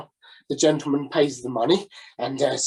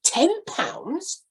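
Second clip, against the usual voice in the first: lightly voiced incredulous policeman; a hint of a shocked bobby; 13 semitones higher than usual.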